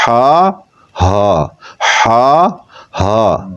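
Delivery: ہا from mid-throat then from the chest, in turns; alternating h sounds. Shown as a demonstration. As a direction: switch ہا between throaty and chesty. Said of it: "ہا ادا ہوتا ہے".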